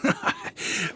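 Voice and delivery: a quiet breathy laugh before owning up; soft chuckle. Acted. Shopkeeper about to admit his agenda gives a soft chuckle. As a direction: laugh lightly, caught out.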